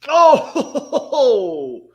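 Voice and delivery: a high, loud burst of laughter; laughing outburst. Real, not acted. Streamer watching a penalty save, laughing outburst. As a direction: crack up as the ball gets saved.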